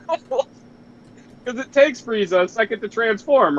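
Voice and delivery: usual speaking voice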